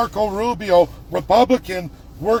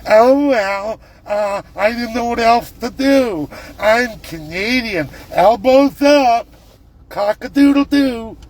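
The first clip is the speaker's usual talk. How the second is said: higher, louder, hammed-up clueless voice; mocking dumb Canadian; 3 semitones higher, 4 dB louder than usual. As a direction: sneer at them by playing the fool. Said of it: "perverted Canadians who would let".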